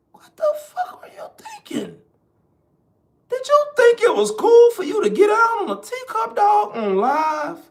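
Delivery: high, cracking outraged cry; shocked cry of outrage; incredulous outburst. Retold or imitated. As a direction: yell it in disbelief, voice breaking up high. Incredulous rant at the streamer.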